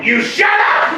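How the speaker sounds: high shouted you shut up; mock shout back. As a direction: fire it back at the table. Retorting in a mock family fight.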